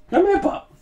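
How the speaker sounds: high-pitched coaxing pet call; calling the dog. Real, not acted. To the scared dog, high coaxing call. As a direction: coax a pup in a high little voice.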